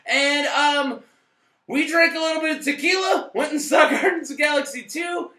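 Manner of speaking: loud, high show-host intro; show intro shout. Acted. Opening the show for viewers, loud host shout. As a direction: greet the internet like a rowdy host.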